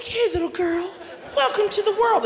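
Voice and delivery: high-pitched imitation of a doting mother; cooing new mother. Wife welcomes baby unaware of stitching, high sweet coo.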